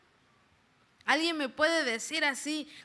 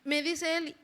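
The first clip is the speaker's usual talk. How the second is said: normal talking voice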